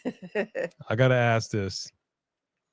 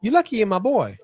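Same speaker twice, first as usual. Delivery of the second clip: high mock scolding; 7 semitones higher, faster than usual. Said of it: "You're like".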